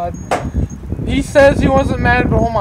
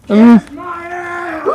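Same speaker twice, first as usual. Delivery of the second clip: high slow wailing laugh-shout; laughing cry; 5 semitones higher, 4 dB quieter, much slower than usual. Real, not acted. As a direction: wail it through laughter. Laughing panic at damage.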